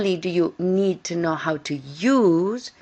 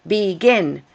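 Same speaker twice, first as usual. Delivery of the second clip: "begin" with a strong, louder second syllable; stress on last syllable; 6 dB louder than usual. Shown as a demonstration. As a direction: punch the end of "begin", be-GIN. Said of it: "the stress is on the last syllable".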